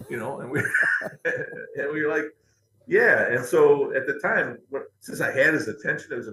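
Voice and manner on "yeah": eager yes. Retold or imitated. Eager reply to offer.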